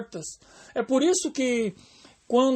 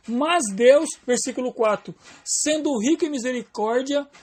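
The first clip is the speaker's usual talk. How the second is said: ordinary talk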